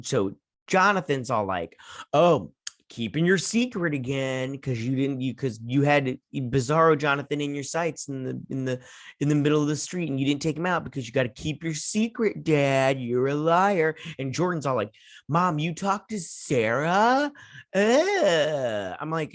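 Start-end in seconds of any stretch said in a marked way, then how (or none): 2.1-13.9 higher-pitched mocking teen whine; obnoxious whining. Jonathan accusing his dad of keeping secrets, obnoxious imitation. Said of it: "they are obnoxious in this part". 15.3-19.0 high, drawn-out mock teen outrage; super annoying. Jordan confronting his mom about Sarah, super annoying imitation. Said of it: "like, super annoying".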